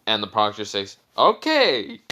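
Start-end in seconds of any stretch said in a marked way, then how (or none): 1.2-2.0 high, loud 'okay'; hasty loud agreement. Retold or imitated. Proctor asked to end the match, high loud agreement. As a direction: blurt it out, pitched way up.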